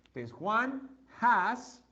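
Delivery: Juan has said slowly, word by word; slow model phrase. Shown as a demonstration. Teacher slowly modelling Juan has for pupils. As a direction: spell out Juan has for the class. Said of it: "teacher models slow English".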